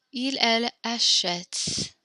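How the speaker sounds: slow, clear teacher model; slow model pronunciation. Shown as a demonstration. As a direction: spell out il, elle achète slowly like a teacher. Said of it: "note all three of these sound the exact same".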